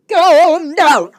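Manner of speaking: loud, high calm down; exasperated loud echo; emphatic loud repeat. Retold or imitated. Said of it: "I kid you not".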